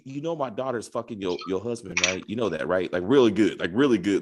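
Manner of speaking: low mock-serious impersonation; imitated TV character. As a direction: drop the pitch way down, as if playing someone breaking news. Acting out a TV character confiding.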